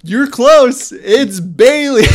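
high, loud, slow, laughing; laughing correction. Retold or imitated. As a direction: correct him while laughing. Producer corrects wrong name.

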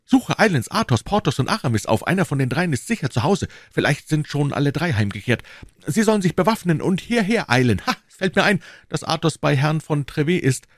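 high, agitated commands; excited, hurried-sounding urgency; urgent orders. A narrator's reading. Urgent orders to his servant.

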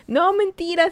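high, loud contradiction; the ex refuses to believe she is at home; insistent denial. Retold or imitated. The woman voices her drunk ex loudly calling her a liar.

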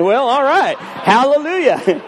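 bemused cheer, stretching hallelujah; amused approval. Retold or imitated. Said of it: "I was like".

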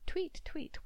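high birdsong tweet; bird chirp. Acted.

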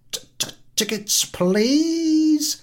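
high, loud stuttered ticket call; stammering call. Acted. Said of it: "Potyarkin addresses".

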